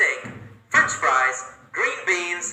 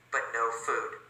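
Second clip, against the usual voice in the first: disappointed hush; 6 semitones lower, 12 dB quieter than usual. A narrator's reading. Waiting grows, dropped voice.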